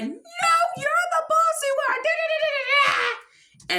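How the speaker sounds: very high squealed retort; mock cartoon yelling, high-pitched; yelling back. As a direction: screech back and tumble into gibberish. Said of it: "Susie's yelling back".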